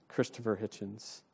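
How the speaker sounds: ordinary talk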